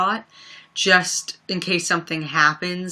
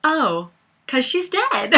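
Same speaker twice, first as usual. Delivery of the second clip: quoting the song line; 6 semitones higher than usual. Retold or imitated. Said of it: "that part with".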